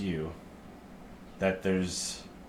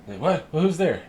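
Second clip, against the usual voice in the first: much higher, louder, caught off guard; startled halfling; 9 semitones higher, 7 dB louder than usual. Acted. Halfling startled by strangers at the farm, high and loud. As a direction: jump at the sudden voice, squeaky and loud.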